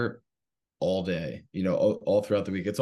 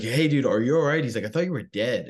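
raised, louder call through the window; startled stranger calling out, higher and louder; concerned stranger; 5 semitones higher, 5 dB louder than usual. Retold or imitated. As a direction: check on a man slumped in his car. Stranger knocks on car window.

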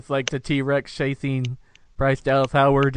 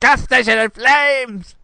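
shouted movie quote; 12 semitones higher, 6 dB louder than usual. Pretend.

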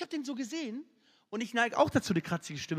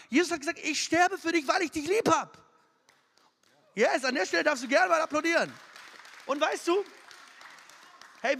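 loud, high, driving sermon voice; fired-up preaching; 5 semitones higher, 5 dB louder than usual. Acted. Youth pastor preaching to teens, loud and driving. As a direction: preach it to the kids full force.

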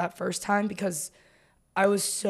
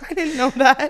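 laughing; 6 semitones higher, 4 dB louder than usual. Real, not acted. Laughing at friend's teasing.

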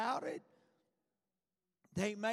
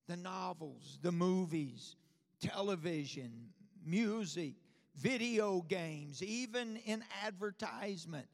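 ordinary talk, nothing marked

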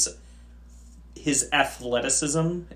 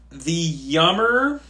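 slow drawn-out announcement; 5 semitones higher, 6 dB louder, much slower than usual. Pretend.

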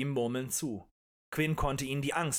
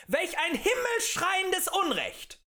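high, drawn-out cry of indignation; loud, slow rabble-rouser outrage; outraged cry; 3 dB louder, slower than usual. A narrator's reading. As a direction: cry it out to a whole room. Agitator rants at the tavern crowd.

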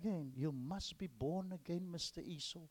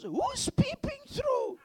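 much higher, loud, slow boss voice; gruff boss; 8 dB louder, slower than usual. Acted. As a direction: call out as the startled boss. Boss voiced in funny story.

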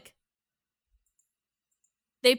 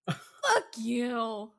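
low, softer deadened curse; subdued curse; 4 semitones lower, 5 dB quieter than usual. Real, not acted. Host cursing Gideon after the outburst, subdued. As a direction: let it drop, spent after yelling.

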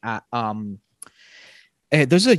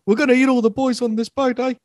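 high mock Maori cannibal; 13 semitones higher, 6 dB louder than usual.

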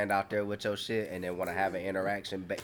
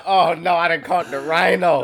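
much louder, higher hammed-up panic; mock panic; 7 semitones higher, 11 dB louder than usual. Host retelling his panic at a rhinovirus diagnosis, loud mock wail. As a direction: wail it like it's a death sentence.

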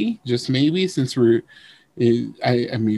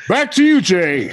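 mock news anchor; 2 semitones higher than usual. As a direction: toss it back like a TV anchor.

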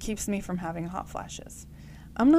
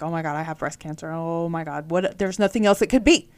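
mock panic; 2 semitones lower, 8 dB louder than usual. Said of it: "like, freaking out".

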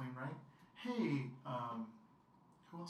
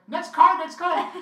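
frantic shout for the next card; panicked shout; 14 semitones higher, 18 dB louder, slower than usual. Retold or imitated. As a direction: yell it in a panic.

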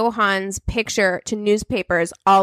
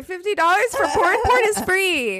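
higher pitch, laughing through words; 7 semitones higher than usual. Real, not acted. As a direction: laugh while you talk. Laughing at a joke.

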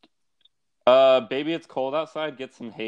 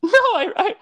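much higher, squeaky falsetto protest; mock woman's voice. Parodying the woman who wants to leave, high falsetto.

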